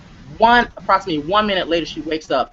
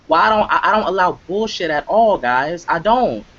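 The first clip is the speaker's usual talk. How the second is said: ordinary talk, nothing marked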